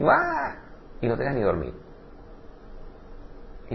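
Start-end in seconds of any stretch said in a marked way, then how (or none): 0.1-0.5 sudden high exasperated yelp; hammed-up frustrated ah; mock cry. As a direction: let out a comic high shriek.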